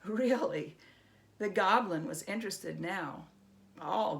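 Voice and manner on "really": usual speaking voice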